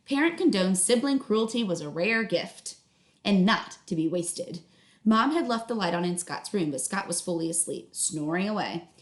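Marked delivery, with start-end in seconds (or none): none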